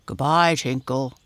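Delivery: loud bear's farewell call. A narrator's reading. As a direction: call out as a storybook bear. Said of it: "called Dido".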